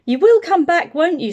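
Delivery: put-on a girl begging her friend to return; little girl voice. Jenny begs the bear.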